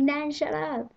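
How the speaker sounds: granddaughter's embarrassed outburst; embarrassed giggly plea. Retold or imitated. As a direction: scold nan through giggles. Embarrassed by nan in shop.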